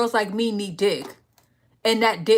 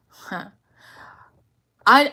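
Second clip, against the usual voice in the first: ordinary talk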